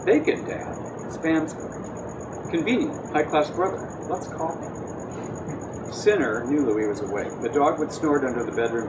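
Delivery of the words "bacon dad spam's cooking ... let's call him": drowsy unvoiced murmur. A narrator's reading. Louis's sleepy inner mutter.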